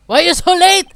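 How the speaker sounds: loud complaint. Acted. Scene: prosecutor scolding Detective Gumshoe, loud complaint. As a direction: yell at the latecomer.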